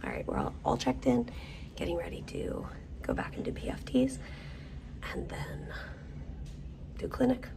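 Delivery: hushed whisper. Real, not acted. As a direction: whisper it quietly. Whispering in the clinic.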